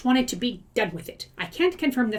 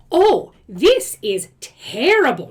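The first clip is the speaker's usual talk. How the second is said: louder, slow "Oh, this is terrible"; loud dismay; 4 semitones higher, 8 dB louder, slower than usual. Real, not acted. Reacting to the reader's ordeal, loud slow dismay. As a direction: let the outrage swell slowly.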